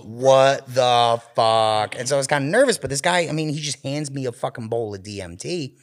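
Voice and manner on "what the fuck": drawn-out, loud disbelief; hammed-up stunned reaction; slow baffled what the fuck. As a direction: stretch each word in shock. Reacting to writhing guy.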